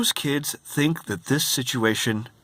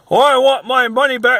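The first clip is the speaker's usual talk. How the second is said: high, loud mock whine; mocking the guest who wants a refund; demanding guest; 11 semitones higher, 7 dB louder than usual. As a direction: squeal it like a spoiled guest.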